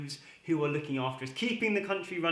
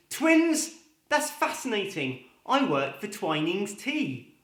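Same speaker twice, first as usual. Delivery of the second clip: high startled yelp, then slow deadpan; surprised father; 6 semitones higher, slower than usual. Acted. New father hears twins news, startled then deadpan. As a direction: squeak the word, then land the punchline slowly.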